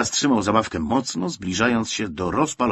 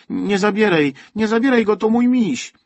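put-on high, loud protest of a small boy; crying out; 11 semitones higher, 7 dB louder than usual. A narrator's reading. Boy begs the soldier not to take his teddy, crying out. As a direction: shout it in a child's high, urgent voice. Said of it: "wykrzyknął Wojtuś".

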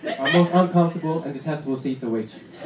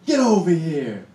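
mock yell; 4 dB louder, slower than usual. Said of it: "grabs me by the collar and yells".

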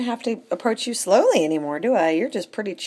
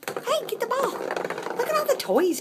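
much higher, slow excited coaxing; playful pet talk. Acted. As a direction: urge the kitty to play in a cooing voice. High pet talk urging cat to play.

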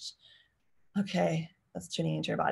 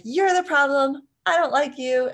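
mock angry child; 8 semitones higher, 7 dB louder than usual. Child lashing out at parent, high angry accusation. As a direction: hurl it like an upset child. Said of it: "Another is like".

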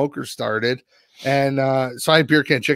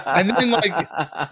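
laughing; 6 semitones higher, 3 dB louder, slower than usual. Real, not acted. Laughing while recounting bad day.

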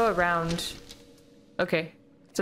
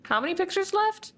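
usual speaking voice

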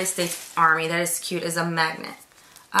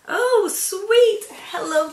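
delighted squeal; 12 semitones higher, 5 dB louder, slower than usual. Real, not acted. Reads a card, delighted.

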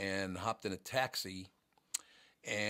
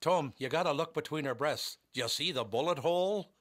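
higher-pitched imitation of an Irish cabbie; attempted Irish accent; 6 semitones higher, 4 dB louder than usual. Taxi driver showing Tom the bullet hole, imitated Irish accent. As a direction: urge the listener on in amateur Irish. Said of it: "The driver says to me".

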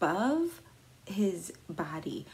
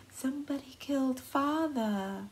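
a light put-on old-fashioned accent on the quote; quoting Lizzie with a faint foreign lilt, a bit higher; slight accent; 7 semitones higher than usual. Retelling Lizzie's words to the neighbor, in a slight accent. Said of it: "I said that with, like, a slight accent".

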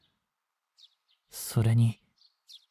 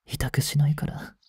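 soft whisper, promising no pain; whispered coaxing; 2 semitones lower, 8 dB quieter than usual. Acted. Younger vampire whispers to the girl he wants to bite, coaxing. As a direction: lull her into giving in.

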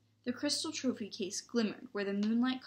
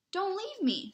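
high, anxious plea; pleading; 10 semitones higher, 4 dB louder than usual. A narrator's reading. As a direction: beg in alarm not to be abandoned. Scared boy begs not to be left.

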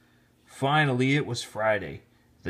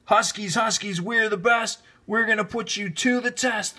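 high, chanted team shout; shouted cheer; 9 semitones higher, 3 dB louder than usual. Acted. Team shouting their cheer before the last period. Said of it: "the whole team shouted".

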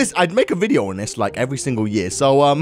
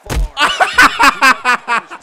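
high, rolling laughter; high cackling burst; gleeful laugh; 6 semitones higher, 4 dB louder than usual. Real, not acted. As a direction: laugh hard at the mayhem. Laughs at the hit.

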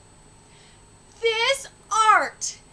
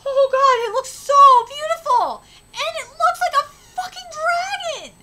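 awed; 6 semitones higher, 4 dB louder than usual. Real, not acted. Streamer awed by dragon art.

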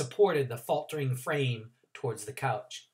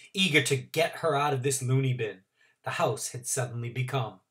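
ordinary delivery, nothing marked

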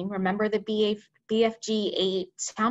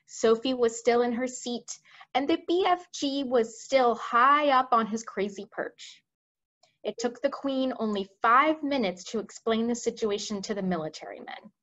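plain reading voice

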